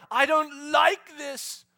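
loud, high, very slow whine; mock whine. Arrogant believer to God, slow loud whine. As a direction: drag out the complaint. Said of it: "we can start going".

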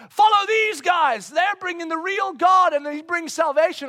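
high hammed-up proclamation; mock shouting girl.

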